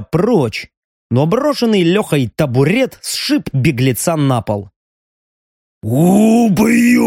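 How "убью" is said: roaring threat, high and loud; growled. A narrator's reading. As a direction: bellow it like an enraged brute. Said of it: "прорычал здоровяк".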